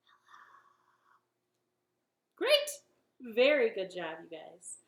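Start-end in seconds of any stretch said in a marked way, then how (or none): 0.1-1.2 faint breathy whisper; whispered hello. Shown as a demonstration. Host whispers hello for the quiet round. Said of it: "say hello as quiet as we can".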